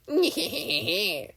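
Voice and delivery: high goofy nyeh-heh laugh; a silly skeleton's cackle; Papyrus laugh. Acted. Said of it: "I was always more of a".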